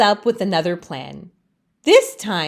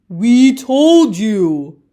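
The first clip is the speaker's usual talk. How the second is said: slow, louder mocking chorus; taunting leaves; 7 dB louder, slower than usual. Other leaves taunt Leaf, slow and loud. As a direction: rub it in, slow and smug. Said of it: "taunt the other leaves".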